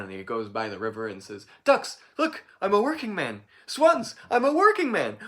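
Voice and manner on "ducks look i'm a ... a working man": very high and loud, peaking on swans; excited shouting. Acted. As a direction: crow it out to the river birds. Jack shouting at the river.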